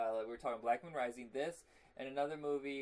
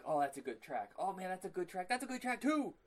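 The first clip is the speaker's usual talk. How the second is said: gushing more and more over the track; rising fanboy delight; mock excited; 5 semitones higher than usual. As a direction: pitch climbing to a high excited gush.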